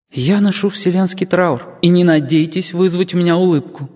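put-on high-pitched young girl's voice, solemn; stern grieving girl, pitched well up; mournful girl. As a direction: declare it defiantly in a high girlish voice.

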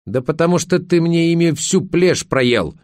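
high-pitched, exasperated outburst; angry yell. A narrator's reading. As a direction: snap back at a maddening husband. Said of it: "закричала Верка". (возмущённый крик)

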